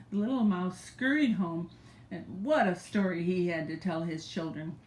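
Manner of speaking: ordinary delivery, nothing marked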